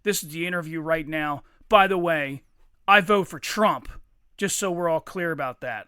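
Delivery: loud mock tough-guy delivery; imitating a loudmouth. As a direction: lay it down like a belligerent big guy. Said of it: "He said".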